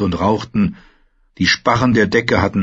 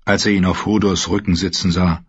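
ordinary delivery, nothing marked

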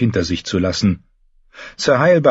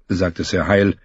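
ordinary delivery

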